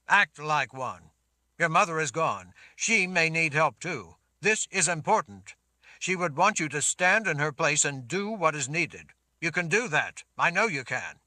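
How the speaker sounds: loud, steady man's voice; firm reassuring Richard. Acted. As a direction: firmly settle a panicked girl. Richard urges Sammy.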